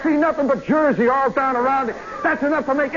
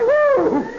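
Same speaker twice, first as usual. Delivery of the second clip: high-pitched acted "woo"; high whoop; 11 semitones higher, 4 dB louder than usual. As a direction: whoop as if the ride drops away. Acts out the ride's plunge.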